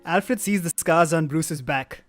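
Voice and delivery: usual speaking voice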